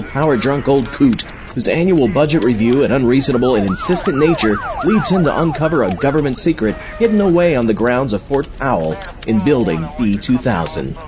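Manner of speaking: ordinary talk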